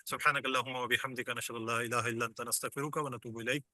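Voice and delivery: soft, quiet Arabic recitation; آہستہ دعا; closing prayer. Acted. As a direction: murmur the closing dua.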